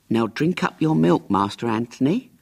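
put-on a tired nurse pressing the child; nagging nurse. Nurse tells child drink milk.

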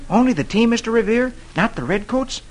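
put-on high, eager young lad; eager boy voice. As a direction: ask like a keen boy. Boy asks leader.

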